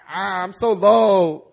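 hammed-up fake lowliness; mock false humility. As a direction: overplay the pitiful martyr.

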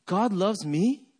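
slow "God loves me?" rising at the end; questioning. Shown as a demonstration. Preacher responds to verse four, slowly questioning. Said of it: "as a question mark".

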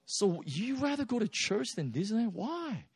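put-on high, curious parent asking his little girl; playfully puzzled question, pitched well up; mock questioning a child.

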